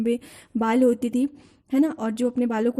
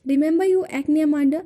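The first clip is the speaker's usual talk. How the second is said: slow, deliberate poem reading, a little louder; poem recitation; 5 dB louder, much slower than usual. Acted. Teacher reciting the mother's nagging to students, slow recitation. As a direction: read each line out slowly, as if to a class.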